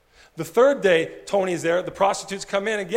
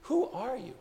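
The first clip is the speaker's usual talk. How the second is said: amazed whisper; 4 semitones higher, 9 dB quieter than usual. Retold or imitated. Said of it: "and he says".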